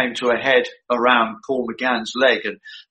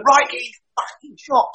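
higher, mock-gruff director's command; retold angry order; 8 semitones higher than usual. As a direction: snap the order like a fed-up director. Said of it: "And he said".

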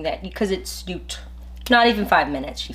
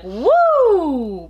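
mock excited woo, a touch louder and higher; excited whoop; 8 semitones higher, 5 dB louder than usual. Friend mimicking her hyped caller with a cheer. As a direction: whoop like she's on top of the world.

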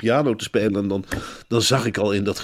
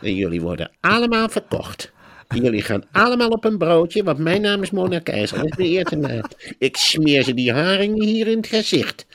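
high-pitched hammed-up fish-seller declaration; Mona Keizer; 8 semitones higher than usual. Politician addressing her bucket of dead fish, high hammed-up defiance. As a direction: play her as a mock-heroic market woman, voice pushed high. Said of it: "En die zei gewoon tegen die emmer".

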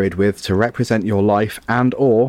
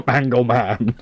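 laughing through "Mangle man", slowed; amused, half-laughed repeat; 2 semitones higher, slower than usual. Real, not acted. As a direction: chuckle the words out slowly. Laughing at the silly phrase.

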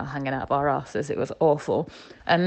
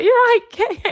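much higher, louder mum calling out; mum's cheery greeting; 9 dB louder than usual. Retold or imitated. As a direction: chirp it like a mum popping in to say hi. Mum arrives at the shop.

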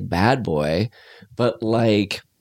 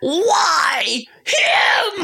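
very high, crying "Why him?"; anguished cry. Retold or imitated. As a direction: wail "Why him?" like breaking down.

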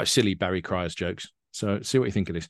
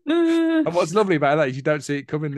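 amused, laughing and louder; laughing while talking; 7 semitones higher, 5 dB louder than usual. Real, not acted. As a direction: talk through a high, bubbling laugh. Laughing after the punchline.

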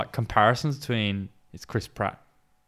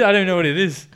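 ordinary talk

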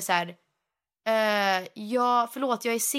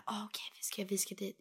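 whispered, barely voiced; whispering; 13 dB quieter than usual. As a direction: hiss it under your breath like a secret. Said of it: "Elsa ... bara så här".

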